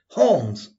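sudden high, loud call, falling away; crying out. A narrator's reading. Narrator as Watson recognizing his friend's voice, crying out. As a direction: cry the name out in astonishment. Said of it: "I cried".

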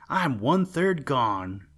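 slightly hammed-up, slow cry; lightly voiced wail of a gingerbread man. A narrator's reading. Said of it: "cried the gingerbread man".

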